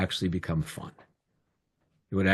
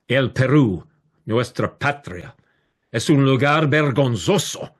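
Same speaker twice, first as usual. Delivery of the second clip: loud soapbox speech in Spanish, raised pitch; imitated political firebrand, ringing and emphatic; fiery orator; 4 semitones higher, 5 dB louder than usual. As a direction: declaim it to the crowded plaza. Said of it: "I had never heard fiery oratory before".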